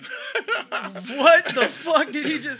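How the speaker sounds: high, slow, laughing shock; stunned and cracking up at the line; laughing disbelief. Retold or imitated. Friend reacting to a punchline, laughing disbelief.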